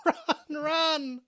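high, hurried cry to flee in mock terror; mock panicked warning. Friend warning others as Candy approaches, mock panic. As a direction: yell a warning and bolt.

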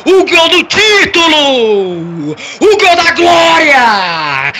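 slow, shouted stadium narration; title-winning goal call. Acted. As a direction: roar out the championship goal. Live goal call for Grêmio. (narração épica de título)

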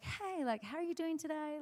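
high, sing-song hello; light, high-pitched social chat; friendly greeting. Retold or imitated. Cheery greeting to the visiting songwriter.

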